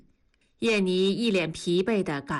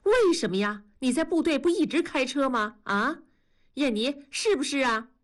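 higher-pitched, anxious questioning; agitated; 6 semitones higher than usual. A narrator's reading. Yanni questioning Liu Qiang about refusing a driver's job, agitated. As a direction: demand an answer, getting worked up. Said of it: "燕妮急了".

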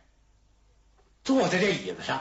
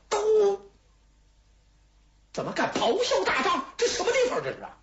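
much higher, outraged shouting; angry roar; 13 semitones higher than usual. Acted. King roars at Xue Kui for his insolence, outraged shouting. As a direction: blast him for shouting in the tent. Enraged king scolds prisoner.

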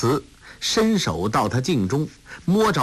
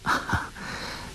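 short, breath-only laugh; quietly amused little laugh; soft chuckle; 9 dB quieter than usual. A narrator's reading. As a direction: chuckle softly under the breath. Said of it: "完颜康笑道".

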